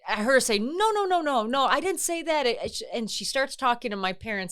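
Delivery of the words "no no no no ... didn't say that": higher, hurried flustered no no; flustered denial. Retold or imitated. Doctor correcting the panicked family, a lightly voiced flustered denial. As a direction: backpedal in a fluster. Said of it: "the doctor was like".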